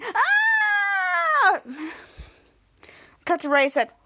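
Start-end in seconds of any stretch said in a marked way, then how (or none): 0.0-1.6 very high, long sustained shriek; imitated scream. Kids scream as the needle grows, imitated scream. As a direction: hold one high mock-scream note. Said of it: "Bo, and Chopper screaming".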